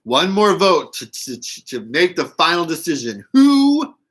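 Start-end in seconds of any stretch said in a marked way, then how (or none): 3.3-3.9 calling out. Pretend. Calling out to viewers.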